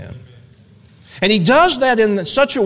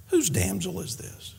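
much softer, almost under the breath; acted smitten murmur; hushed wonder; 10 dB quieter than usual. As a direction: drop right down to a hushed question. Boaz notices Ruth, asks his workers.

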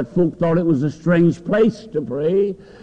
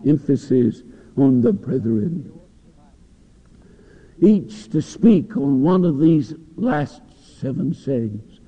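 ordinary talk

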